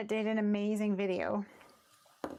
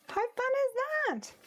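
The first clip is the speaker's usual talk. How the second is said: very high, excited exclamation; squeaky-high delight; delighted enthusiasm. Real, not acted. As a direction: gush in a high-pitched voice. Delighted reaction to viewers' news.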